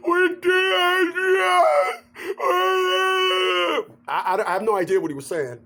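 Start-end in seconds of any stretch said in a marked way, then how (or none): 0.1-3.9 mock crying. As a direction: blubber it out like a weeping champion. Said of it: "he was crying".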